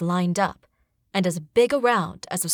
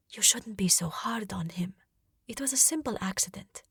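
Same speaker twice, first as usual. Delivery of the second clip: soft unvoiced whisper; 7 dB quieter than usual. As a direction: lean in and whisper it. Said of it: "whisper to him".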